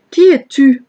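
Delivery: crié fort et plus haut, vers la montagne; appel au loin. Acted. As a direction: lance la question de loin, d'une voix forte. Abner calls across the distance. (Abner crie à David de l'autre côté, voix forte)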